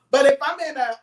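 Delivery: ordinary talk, nothing marked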